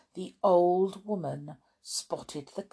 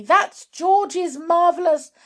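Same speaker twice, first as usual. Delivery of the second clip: high, loud warning; urgent and cut off mid-sentence; alarmed cry; 9 semitones higher, 8 dB louder than usual. Acted. Mother starts warning Grandma about the medicine, urgent cry. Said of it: "cried Missus Cranky".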